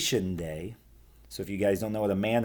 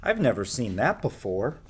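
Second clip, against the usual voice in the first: lively storybook reading; reading to children; 3 semitones higher than usual. A narrator's reading. Storyteller reading a picture book to children, lively. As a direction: read it like a bedtime story.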